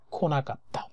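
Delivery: ordinary talk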